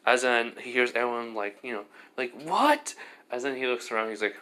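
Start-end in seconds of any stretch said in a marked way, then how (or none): 2.4-3.0 high, loud disbelieving cry; mock shocked crowd. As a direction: shriek it as a stunned classmate. Classmates react in shock.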